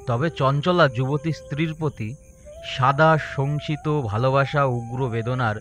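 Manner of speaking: plain reading voice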